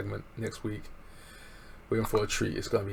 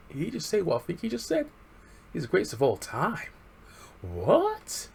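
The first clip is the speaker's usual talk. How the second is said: incredulous; 9 semitones higher than usual. Retold or imitated. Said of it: "talking to his friend like".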